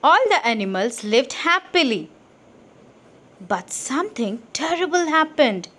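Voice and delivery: ordinary delivery, nothing marked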